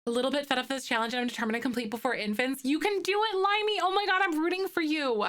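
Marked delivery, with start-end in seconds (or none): 2.6-5.2 excited cheering. Real, not acted. Cheering a viewer on.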